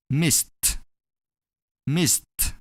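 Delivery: "Missed" in one syllable ending "st". Shown as a demonstration. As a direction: finish "Missed" on a separately released t. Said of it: "pronounce a D as T".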